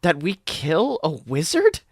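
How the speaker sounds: slow, lightly voiced uneasy question; no German accent, just nervous; hesitant scientist. A narrator's reading. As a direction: drag out the doubt. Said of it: "forgotten to use a fake German accent".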